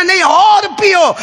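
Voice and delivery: insistent command. Acted. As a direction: insist firmly, drawing it out. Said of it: "repeated command, insisting".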